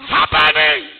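loud, rising prophetic proclamation; loud preacher declaring miracles over the crowd; full-force preaching. Acted. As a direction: thunder the prophecy to the congregation.

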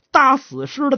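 plain reading voice

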